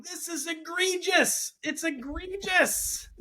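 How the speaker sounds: hammed-up protest, high-pitched; mock yell. Dad thrown out of school, mock high protest. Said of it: "he's yelling".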